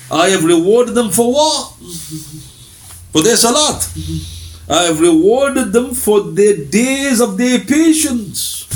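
verse declamation. Acted. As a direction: proclaim it slowly to a congregation.

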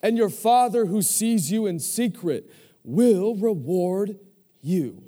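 loud, high, drawn-out sermon climax; full-force preaching. Acted. Preacher proclaiming to the congregation that their Father hears, full-force. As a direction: proclaim it slowly at full voice, stretching each word.

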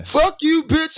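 mock angry yell. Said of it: "So he said".

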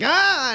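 high-pitched mock yell of alarm; startled yelp. Drunk seeing a giant appear before him, startled high yelp. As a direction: yelp as if jumping back.

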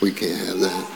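low, hushed mock-defeated report; defeated grumbler. Spies who came back from the land, quietly defeatist. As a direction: drop it right down under the breath.

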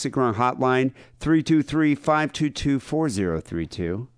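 high, bright promo read; announcer read. Shown as a demonstration. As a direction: sell the hotline number like an ad. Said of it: "reading the hotline number".

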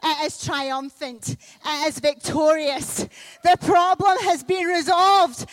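ordinary delivery

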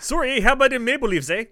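mock Canadian accent. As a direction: play a hockey-mad Canadian, eh. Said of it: "that's the exact accent we use".

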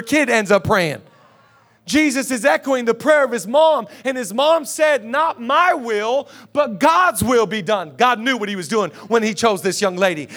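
high, forceful sermon delivery with punched key words; impassioned preaching. Real, not acted. Pastor urging the church to surrender, impassioned preaching. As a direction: preach it hard to rouse the congregation.

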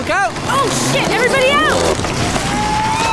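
panicked shout. Acted. Angela warning everyone as the tent falls, panicked shouting. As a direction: scream the warning across the crowd.

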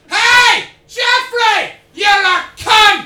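huge high-pitched street heckle, drawn out; imitated full-force yell, words stretched; Scottish man shouting. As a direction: bellow it down the street like an angry Scotsman. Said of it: "this Scottish man ... spies him and went".

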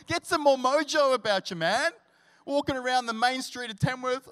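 loud, high fired-up preaching; rally the congregation, voice soaring; full-force preaching. Acted. Pastor rousing the church to hope in full voice.

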